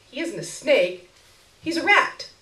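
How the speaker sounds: high, firmly defiant. Acted. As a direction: insist it, defiantly. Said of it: "she said with a tone of defiance".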